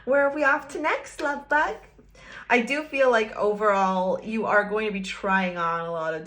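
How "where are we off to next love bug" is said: sweet lover. Acted. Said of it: "and you're gonna be like".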